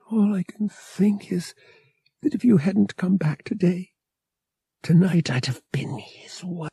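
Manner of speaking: shaken confession, barely voiced; murmur. A narrator's reading. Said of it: "she murmured".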